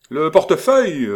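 high, loud shout; crying out. A narrator's reading. Marguerite shouting after Joulou, loud cry. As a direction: shout through the door. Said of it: "s'écria Marguerite".

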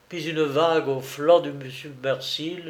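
plain reading voice

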